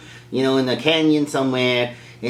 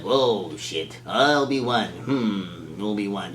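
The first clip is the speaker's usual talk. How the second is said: low, soft dawning recognition; imitated old man taken aback; stunned old man; 6 semitones lower, 5 dB quieter, slower than usual. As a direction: murmur it slowly to yourself, sinking lower. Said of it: "old man has this look on his face".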